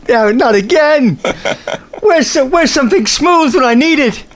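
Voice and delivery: high, hammed-up character voice; put-on character whine. Jokes as character needing moisturizer.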